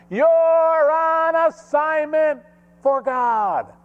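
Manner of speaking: high, driving preacher's challenge building to a shout; full-force preaching. Real, not acted. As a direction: fire questions at the congregation, then shout the answer.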